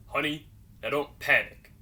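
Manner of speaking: forced calm, brushing off panic; calm dad voice. Retold or imitated. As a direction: play it cool despite the injury. Dad with thumb hanging off.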